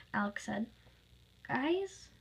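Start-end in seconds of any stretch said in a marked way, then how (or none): 1.5-2.1 high, tentative question; bewildered little query; confused. Acted. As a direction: ask it puzzled, pitched up. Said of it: "confused by the whole thing".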